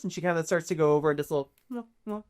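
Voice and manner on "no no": higher and much softer, hushing each one; soothing murmur. Acted. Lady Isolde hushing each adventurer, soft and soothing. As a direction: coo it softly to each stranger. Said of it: "Her voice ... is very reassuring".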